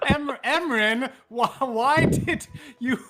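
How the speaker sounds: slow, high, giggling mock reproach; laughing scold. [name] laughing as he blames Emrin for the fart. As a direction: scold the culprit while cracking up.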